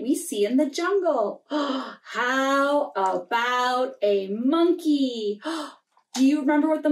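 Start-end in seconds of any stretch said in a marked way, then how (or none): none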